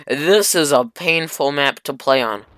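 usual speaking voice

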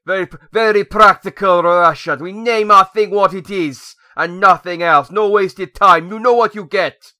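put-on loud, slow, thick Russian accent. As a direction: declare it as a booming, put-on Russian. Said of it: "That was my Russian accent".

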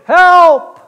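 high, full-volume cry for help; yelling for help. Acted. Man hanging from a branch calls for rescue, yelling. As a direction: holler it out into the canyon. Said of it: "he's yelling".

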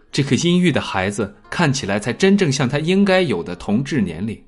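usual speaking voice

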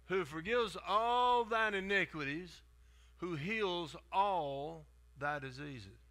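slow, solemn recital of the verse; scripture reading. Acted. Preacher reading the psalm to the church. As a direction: read the King James words deliberately.

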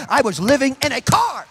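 high, loud testimony building with fervor; full-force preaching. Real, not acted. Preacher telling church of living in a car, shouted preaching. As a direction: cry out your testimony to the crowd.

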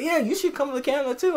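high, breezy impression of Justin's invite; imitating the friend. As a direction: voice him way up and cheerful. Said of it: "He said".

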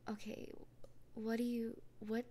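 usual speaking voice

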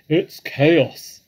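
loud, high, frantic outpouring; agitated badger, pitch climbing high; excited. A narrator's reading. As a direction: pour out the terrible news at full volume. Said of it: "Badger said excitedly".

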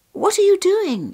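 put-on high, loud little-girl voice; high-pitched, puzzled little girl; little sister. A narrator's reading. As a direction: ask like a startled child. Woken little sister questions her brother.

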